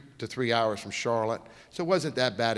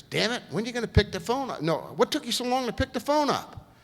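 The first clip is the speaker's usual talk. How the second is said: much higher, fast, exasperated; impatient voice, pitched way up; impatient caller; 10 semitones higher than usual. Retold or imitated. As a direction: play the fed-up caller who waited too long.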